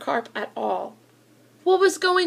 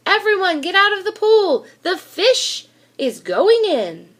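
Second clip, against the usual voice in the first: higher, louder warning shout; yelled; 7 semitones higher, 6 dB louder than usual. A narrator's reading. Fireman yelling at swimmers to leave the pool, loud warning. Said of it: "The fireman yelled".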